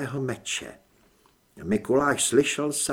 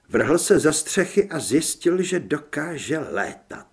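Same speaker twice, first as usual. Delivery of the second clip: plain reading voice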